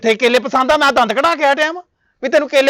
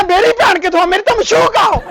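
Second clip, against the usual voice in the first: indignant outburst; 8 semitones higher, 3 dB louder than usual. Acted. Man insists she is his beloved.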